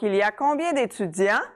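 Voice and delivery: teacher's demo, "d'étudiants" swinging upward; rising intonation. Shown as a demonstration. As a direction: question with "d'étudiants" lifted at the end. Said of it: "That's my rising intonation".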